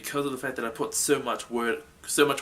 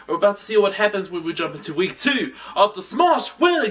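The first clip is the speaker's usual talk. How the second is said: loud, higher-pitched presenter delivery; show host announcer; 7 semitones higher, 7 dB louder than usual. Acted. Host hyping week two of the tournament, loud announcer voice. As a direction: announce it big, building to a shout.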